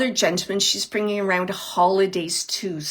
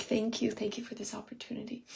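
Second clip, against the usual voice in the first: hushed, quick polite thank-you; softly imitating overly polite spirits; 10 dB quieter, faster than usual. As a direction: murmur it fast and very politely. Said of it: "They're just so polite".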